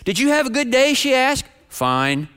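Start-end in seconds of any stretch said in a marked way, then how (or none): 0.0-0.9 wife's cheery question, imitated. Wife asking husband about his day, cheery. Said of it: "contrast between my wife's tone". 1.7-2.3 low, gloomy one-word answer; unenthusiastic. Retold or imitated. Husband brushing off wife's question, unenthusiastic. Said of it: "my lack of enthusiasm".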